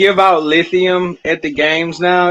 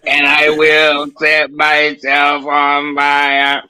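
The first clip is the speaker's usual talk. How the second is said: slow, drawn-out wrestler menace; Kane voice; much slower than usual. Retold or imitated. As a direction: drag every word out like Kane. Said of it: "You sound like Kane".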